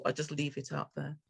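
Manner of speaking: ordinary talk, nothing marked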